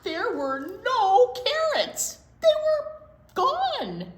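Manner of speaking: high, loud alarmed reading; dramatic storybook alarm. A narrator's reading. Storyteller tells kids the carrots are gone, loud and alarmed. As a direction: make the missing carrots a big shock.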